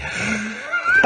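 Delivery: very high, panicky hyperventilating; mock breathless wheeze; breathless gasping. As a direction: imitate someone gasping for breath. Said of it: "she was getting all breathless".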